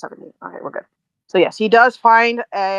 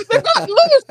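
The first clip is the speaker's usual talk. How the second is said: high-pitched panicked yelp, played for laughs; scared guard; 4 dB louder than usual. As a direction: squeal it like a frightened guard. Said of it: "You have scared him".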